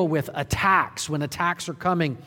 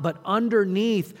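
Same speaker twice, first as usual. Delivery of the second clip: ordinary talk, nothing marked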